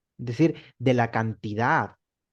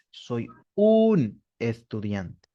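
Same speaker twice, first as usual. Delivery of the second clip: slow Spanish gloss; slow, deliberate classroom translation; 3 semitones lower, much slower than usual. Shown as a demonstration. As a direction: say it slowly so they follow. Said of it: "tengo que utilizar el artículo".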